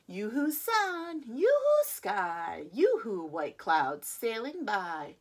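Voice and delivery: ordinary delivery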